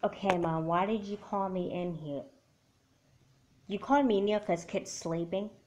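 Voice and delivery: usual speaking voice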